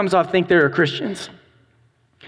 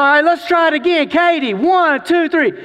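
countdown call; 8 semitones higher than usual. Retold or imitated. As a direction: build suspense like a showman counting down. Teacher retries the countdown.

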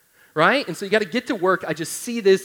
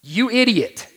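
imagined wife's rebuke; 7 semitones higher, 6 dB louder, slower than usual. Retold or imitated. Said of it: "this is what she says in her mind".

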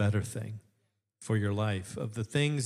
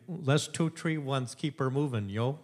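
put-on lightly done Yooper accent; a hint of Upper Peninsula talk; upper michigan accent; 4 semitones higher, slower than usual. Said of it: "heard Upper Peninsula talk? It sounds hilarious".

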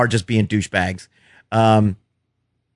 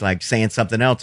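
ordinary delivery

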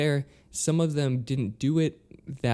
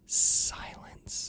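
whispered word; hushed; 6 dB quieter than usual. Retold or imitated. Telling the co-host how the crowd went dead, whispered. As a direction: breathe it out in a whisper.

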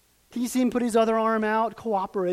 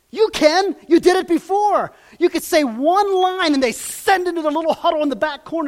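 high and loud pushing; goading inner voice; 9 semitones higher, 8 dB louder than usual. Retold or imitated. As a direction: egg him on, fired up. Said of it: "there's enough in me that says".

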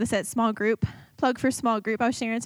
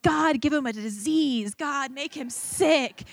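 drawn-out, raised mock-bitter plea; vengeful prayer; 4 semitones higher, slower than usual. Praying awful things on people she hated, slow spiteful plea. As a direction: stretch each curse out slowly. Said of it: "I really was praying, like, awful things".

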